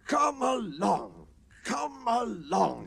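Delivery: slow, high puffing call; puffing engine. A narrator's reading. Sir Handel puffing at his coaches to come along. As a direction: puff the words out slowly. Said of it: "he puffed".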